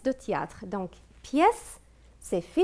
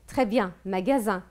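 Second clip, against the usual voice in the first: ordinary delivery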